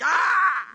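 high-pitched mock cry of amazement; awestruck cry. John peeking through heaven's door, awestruck cry. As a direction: cry out "ah" as if stunned by the sight.

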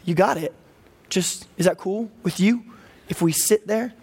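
ordinary delivery

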